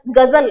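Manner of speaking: गजल with a z in the middle, gazal; buzzed z. Shown as a demonstration. Teacher setting up a spelling task, गजल with buzzed z. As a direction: say गजल with buzzing z. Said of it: "actual में जो pronunciation है".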